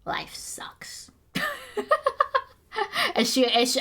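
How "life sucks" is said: sulky whisper for the cat; voicing the cat, a moping whisper; whispered cat pout. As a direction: breathe it out like a pouting cat. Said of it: "She's like".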